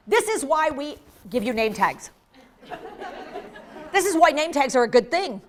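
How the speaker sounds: ordinary talk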